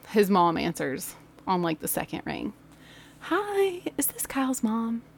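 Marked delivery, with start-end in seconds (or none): 3.2-5.0 soft, higher-pitched re-enacted caller; polite phone voice. Teacher phoning Kyle's mom, polite mock phone voice. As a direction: sweetly open a call to a parent.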